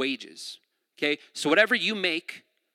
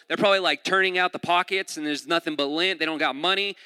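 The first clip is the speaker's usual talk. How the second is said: normal talking voice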